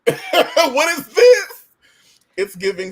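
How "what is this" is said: high, slow laughing cry; laughing disbelief. Retold or imitated. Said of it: "I was like".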